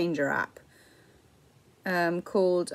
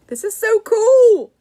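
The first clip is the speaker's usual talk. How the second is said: excited shout; 12 semitones higher, 10 dB louder than usual. Real, not acted. Excited by app.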